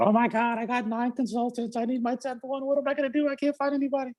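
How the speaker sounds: high, frantic panicked voice; stressed out friend. Retold or imitated. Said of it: "she called me stressed out".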